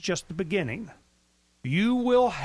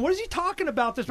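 bewildered quote; 10 semitones higher, faster than usual. Retold or imitated. Acts out baffled columnist's questioning.

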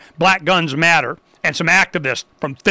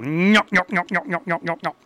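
stooge-like nyok noise; 3 semitones lower, 6 dB quieter than usual. Acted. Imitated comic noise before signoff.

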